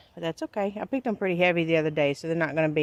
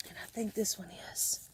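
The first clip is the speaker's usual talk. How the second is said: whispering; 15 dB quieter than usual. Real, not acted. Checking garlic rows closely.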